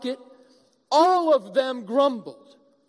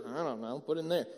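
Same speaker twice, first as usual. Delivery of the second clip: mumbled shrug; 8 semitones lower, 9 dB quieter, faster than usual. Retold or imitated. Couple dumping boxes in room.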